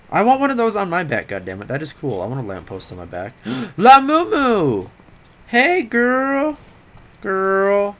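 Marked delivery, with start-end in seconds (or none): 3.4-8.0 high, drawn-out hailing; excited calling out. Pretend. Player greeting Lamumu, excited calling out. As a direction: hail her loudly and playfully. Calls out to game character.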